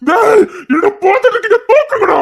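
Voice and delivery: high, loud yell; yelling at the others; shouted order. Acted. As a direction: shout it out at full pitch.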